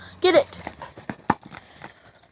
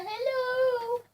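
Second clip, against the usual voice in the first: higher, whiny pet voice; high dog voice; voicing the dog; 7 semitones higher, 11 dB quieter than usual. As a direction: speak for the dog in a high whine. Voicing the dog's hello.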